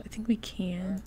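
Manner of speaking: soft unvoiced whisper; whispered aside. Real, not acted. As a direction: murmur it to yourself. Quiet aside about the fridge.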